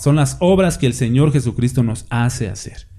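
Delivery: normal talking voice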